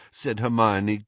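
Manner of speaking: usual speaking voice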